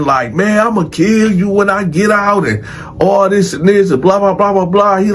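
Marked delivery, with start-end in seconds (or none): 0.3-2.5 higher-pitched threat, no real volume; retold yell at someone leaving; mock hollering. Inmates threatening the departing man, mock hollering. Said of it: "people screaming, hollering".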